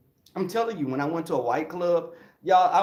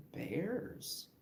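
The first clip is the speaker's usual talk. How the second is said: puzzled echo; 3 semitones higher, 13 dB quieter than usual. Retold or imitated. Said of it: "I was like".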